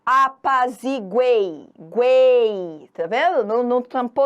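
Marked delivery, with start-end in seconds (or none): 0.0-2.9 slow "Apaziguei", then a louder stretched "guei"; teste do som oral. Shown as a demonstration. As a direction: stretch GUEI out, all through the mouth. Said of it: "tapa o nariz". ("Apaziguei, guei" arrastado, som todo oral)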